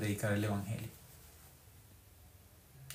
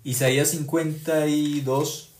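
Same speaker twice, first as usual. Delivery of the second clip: slow announcement; 4 semitones higher, 6 dB louder, much slower than usual. Acted. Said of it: "Con ese versículo finalizamos".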